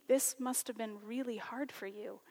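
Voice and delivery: soft, tender whisper; gentle whisper. Real, not acted. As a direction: hush it kindly, like comforting him. Teacher comforting shaken student.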